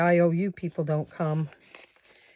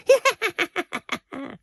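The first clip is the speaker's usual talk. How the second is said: high cackling witch laugh; witch cackle; 12 semitones higher than usual. Retold or imitated. Finishing her witch impression.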